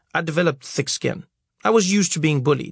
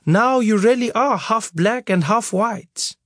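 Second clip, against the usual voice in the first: teasing mother joke; 8 semitones higher, slower than usual. Acted. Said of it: "she said, laughing and pointing".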